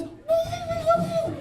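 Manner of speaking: loud, high buffalo whooping call. Retold or imitated. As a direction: bellow it out like a buffalo. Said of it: "And I hear this".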